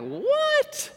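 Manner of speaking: very high-pitched mock disbelief; incredulous surprise. Preacher as disciples hearing about the widow, incredulous. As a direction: squeal it in hammed-up astonishment.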